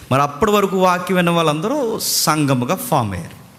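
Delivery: usual speaking voice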